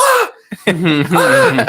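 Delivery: high, loud screams breaking into a laugh; retold screaming. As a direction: scream like you can't believe it. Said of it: "screaming over and over".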